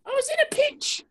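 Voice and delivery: high, put-on British with a fake sob; wounded English judge, hammed up; mock British whine.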